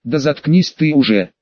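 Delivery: ordinary delivery, nothing marked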